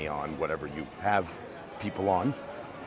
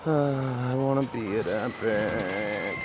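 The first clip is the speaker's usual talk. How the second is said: slow, drawn-out whine; 3 semitones higher, slower than usual. Real, not acted. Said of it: "I sound like a whiny little child".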